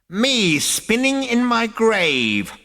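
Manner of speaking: high, slow, loud ancient bark; barking old ghost. Acted. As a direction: bark it out in outrage. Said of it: "barked the ancestor".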